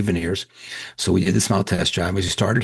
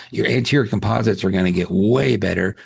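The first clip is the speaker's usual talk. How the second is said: usual speaking voice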